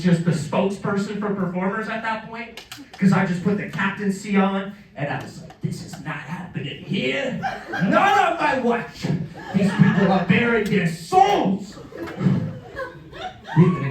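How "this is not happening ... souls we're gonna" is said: mock heroic vow.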